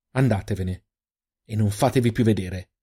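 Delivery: ordinary delivery, nothing marked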